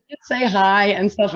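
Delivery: ordinary delivery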